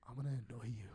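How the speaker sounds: low, soft teasing threat; mock menacing tease. Partner announces the plan to annoy her, low mock menace. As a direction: drop low and quiet to tease.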